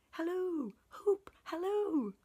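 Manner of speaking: very high, loud hunting-style holler; excited halloo. A narrator's reading. Scrooge cheering on Friday from the window, shouting. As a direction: holler after the runner.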